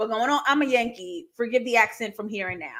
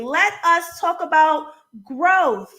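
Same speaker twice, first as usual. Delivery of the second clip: each word slowed and louder; slow, emphatic; 4 semitones higher, 4 dB louder, slower than usual. Real, not acted. Host rallying chat to the topic, slow and emphatic. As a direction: spell it out deliberately.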